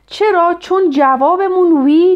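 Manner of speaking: ordinary talk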